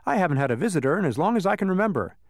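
high, quick, delighted; happy chatter. A narrator's reading. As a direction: rattle it out, pleased to have company. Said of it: "he cried happily".

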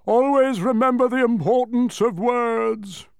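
loud, high, drawn-out kingly call; shouted reminder. A narrator's reading. King calls advice after the boy, shouting. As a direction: shout it slowly across the distance. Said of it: "shouted Azaz".

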